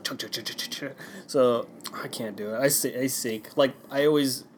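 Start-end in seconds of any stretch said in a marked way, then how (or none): 0.0-0.8 soft, low, choppy splash noises; imitated foot splashes. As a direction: imitate little feet fluttering on water. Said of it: "then my feet are like".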